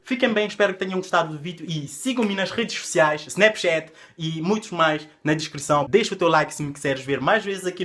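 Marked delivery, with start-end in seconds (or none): none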